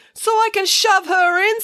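loud, very high outburst; exasperated bank robber. Acted. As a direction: burst out in fed-up frustration. Robber snaps about Nanny.

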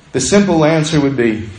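ordinary talk, nothing marked

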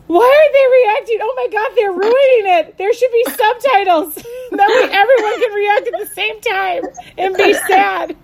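laughing, high-pitched mock frustration; exasperated. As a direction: gripe at the screen in mock outrage. Retelling frustration at slow reactions.

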